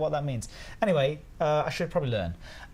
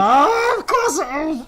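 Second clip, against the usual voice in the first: high, loud wail of realization; long, loud exasperated cry; frustrated outcry; 10 dB louder than usual. Real, not acted. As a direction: cry out at the obvious answer you missed. Groans at missed quiz answer.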